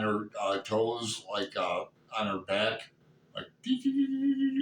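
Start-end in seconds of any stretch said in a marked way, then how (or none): 3.6-4.6 imitated creepy noise. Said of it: "walking like, like that".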